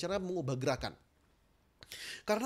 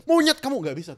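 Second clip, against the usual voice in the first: failed loud insult; 6 semitones higher, 10 dB louder than usual. Shown as a demonstration. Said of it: "ngomong monyet aja pun nggak bisa".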